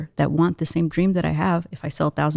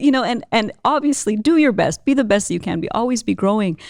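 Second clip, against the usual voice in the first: ordinary talk, nothing marked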